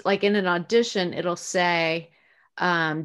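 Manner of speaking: normal talking voice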